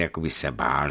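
ordinary delivery

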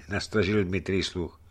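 ordinary delivery

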